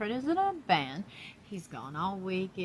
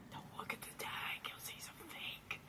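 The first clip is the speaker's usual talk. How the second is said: conspiratorial whisper; 9 semitones higher, 14 dB quieter than usual. Real, not acted. Telling viewers not to check the doll's tag, whispering. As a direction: hush it like a secret. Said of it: "secretive aside about the tag".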